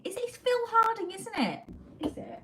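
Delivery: high, loud, quick burst of recognition; excited realisation. Real, not acted. Suddenly placing the Time Team man, excited. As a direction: blurt it out high and fast.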